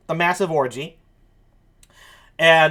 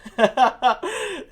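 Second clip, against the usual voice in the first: higher-pitched chuckle; laughing; 7 semitones higher than usual. Real, not acted. Host reacting to Maury's quip, high chuckle. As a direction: giggle in reply to a quip.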